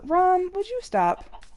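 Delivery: high slow scolding. Real, not acted. Scolding her pet dog.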